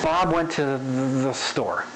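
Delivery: slow line with "the" drawn out before "store"; varied spacing. Shown as a demonstration. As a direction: linger on "the". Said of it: "where you linger, and where you rush".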